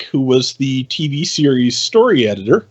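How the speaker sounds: ordinary delivery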